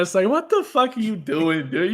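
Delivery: ordinary delivery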